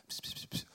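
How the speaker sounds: soft voiceless pss sounds; imitated whispering. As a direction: mimic someone whispering in an ear. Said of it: "fluisterde hij even iets in het oor". (nagebootst gefluister)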